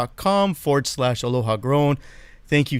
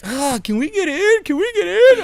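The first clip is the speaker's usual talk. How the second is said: mock wheedling, climbing higher and louder; eager girls; 5 dB louder than usual. Girls asking for the shady spot, high mock pleading.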